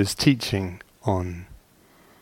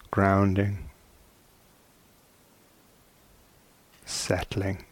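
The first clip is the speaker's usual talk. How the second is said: slow, soft words with long silences between; calm, spacious meditation guidance; guided meditation; 4 dB quieter, slower than usual. Acted. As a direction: ease listeners into stillness, one unhurried phrase at a time.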